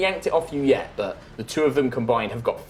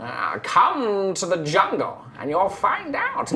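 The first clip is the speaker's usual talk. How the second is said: slow, creeping invitation, rising much higher; eerie skeleton; 6 semitones higher, slower than usual. Acted. Skeleton lures the curious player toward the portal, slow and creepy. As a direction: draw it out like a sinister skeleton.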